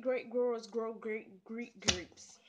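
ordinary delivery

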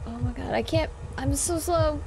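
frantic cries while fleeing; being chased. Real, not acted. Fleeing a monster in horror game.

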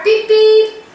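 high beep-beep; two bright high-pitched toots; car horn. Shown as a demonstration. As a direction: imitate a toy car honking. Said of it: "there's the car".

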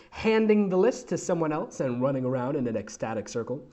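plain reading voice

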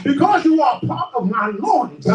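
high, driving sermon cry; fervent preacher with stretched words and gasped breaks; full-force preaching. Real, not acted. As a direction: preach it out in punched, rising phrases.

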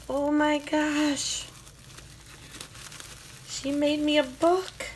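high, slow delighted gush; thrilled over the gift; excited. Real, not acted. Excited unboxing a handmade gift.

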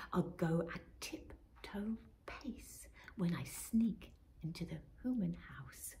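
sneaky whisper. Acted. Robin whispers her sneaking rhyme again. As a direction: whisper each step of the plan.

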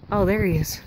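ordinary delivery

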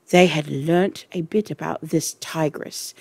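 ordinary delivery

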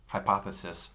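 usual speaking voice

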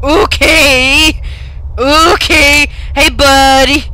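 loud, startled exclamations. Real, not acted. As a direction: react loudly to a sudden surprise. Startled by something in game.

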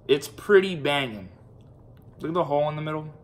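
chewing while talking; mouth full. Real, not acted. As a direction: food in mouth. Seen 1: Holds bitten marshmallow at mouth, lifts it to lips mid-talk.

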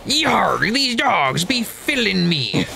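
high, slow hammy pirate. Pretend. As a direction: growl it like a cartoon pirate. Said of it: "You sound like a pirate".

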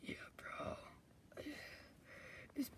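unvoiced hushed whisper. Freed friend whispering to his buddy. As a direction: whisper it quietly.

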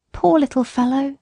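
a hint of a troubled mother, pitying; worried country mother. A narrator's reading. Mother speaking of her sick boy, lightly voiced pity.

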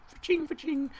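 high squeaky fa-ching noise; high sound effect. Acted.

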